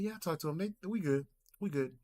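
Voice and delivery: high-pitched, breezy uncle impression; easygoing uncle in a high voice; uncle's tone. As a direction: imitate your uncle reassuring everyone, unbothered. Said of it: "The tone I'm giving you".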